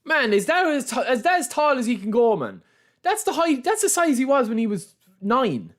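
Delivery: high, exasperated moaning; exasperated disbelief. Real, not acted. Streamer complains to chat about the height cap, high and exasperated. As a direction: whine about it in mock outrage.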